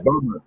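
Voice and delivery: BUMMER with a sounded American r; American pronunciation. Shown as a demonstration. Showing how Americans say the name, American BUMMER. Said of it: "easy for the Americans to say it's Bummer".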